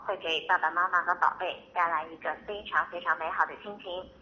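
ordinary talk

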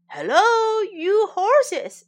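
high, loud greeting call. A narrator's reading. Horse greeting three strangers, calling out.

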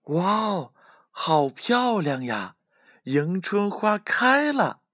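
high, drawn-out wonder; delighted little rabbit. A narrator's reading. Rabbit seeing the flowers in bloom, thrilled high voice. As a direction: exclaim in a slow, high squeal of delight. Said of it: "小白兔高兴地蹦蹦跳跳".

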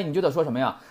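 usual speaking voice

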